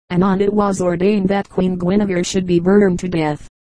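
ordinary delivery

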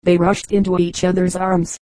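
plain reading voice

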